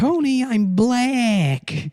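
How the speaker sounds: very high, loud, stretched falsetto; mock character cry. Comic calling to Tony as a character, drawn-out high cry. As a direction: drag it out in a hammed-up squeal.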